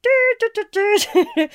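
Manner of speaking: high, loud hooty "doo" falsetto; mock a thin, hooting countertenor; horrible countertenor falsetto. Shown as a demonstration. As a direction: demo of bad male falsetto. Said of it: "can sound really horrible and countertenory".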